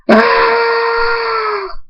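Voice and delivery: very long, high-pitched scream; sustained shriek of agony. A narrator's reading. Hunter as the hacksaw hits bone, screaming. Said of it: "Hunter screams".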